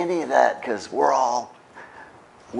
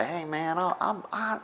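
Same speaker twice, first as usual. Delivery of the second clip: sheepish apology; 5 semitones higher, 12 dB quieter than usual. Retold or imitated. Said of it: "call them up and say".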